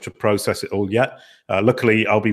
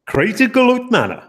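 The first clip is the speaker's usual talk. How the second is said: jokey, hammed-up American accent, pitched way up; 13 semitones higher, 3 dB louder than usual. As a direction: tosses it off in a bad mock American twang. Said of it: "That was my American accent again".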